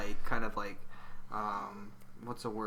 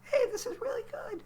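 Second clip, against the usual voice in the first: put-on high squeaky child voice, pitched way up; little kid voice. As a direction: talk like an excited little kid. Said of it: "That's my little kid voice".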